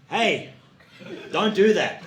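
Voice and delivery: lightly acted telling-off; bossy supervisor. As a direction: call out a correction like a supervisor. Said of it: "I would be in a supervisory role".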